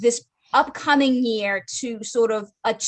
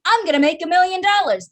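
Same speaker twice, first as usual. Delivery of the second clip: mock naive; 8 semitones higher, 5 dB louder than usual. Mock naive goal quote.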